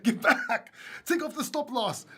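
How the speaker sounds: high, loud frantic pleading at the chart; mock panic with a laugh; panicked trader. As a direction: shout it like a trade going wrong, laughing. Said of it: "I'm too emotional".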